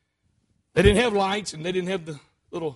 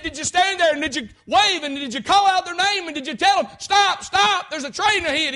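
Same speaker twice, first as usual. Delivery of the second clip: high, loud, driving questions; forceful cross-examination; 9 semitones higher, 7 dB louder than usual. Acted. Lawyer grilling the watchman on trial, forceful questioning. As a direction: drive the questions at the witness.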